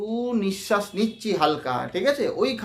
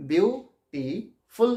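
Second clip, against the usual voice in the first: Beautiful in three parts, বিউ, টি, ফুল, with pauses; slow, syllable by syllable; much slower than usual. Shown as a demonstration. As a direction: break beautiful slowly into three separate syllables. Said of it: "আস্তে আস্তে উচ্চারণ করে". (Beautiful আস্তে আস্তে ভেঙে ভেঙে)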